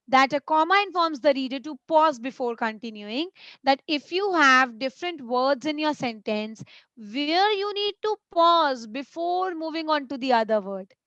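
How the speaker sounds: usual speaking voice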